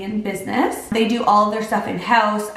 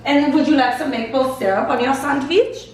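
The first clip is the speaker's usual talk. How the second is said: higher-pitched fake German-accented offer; bad German accent; 4 semitones higher than usual. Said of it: "can't even do a German accent".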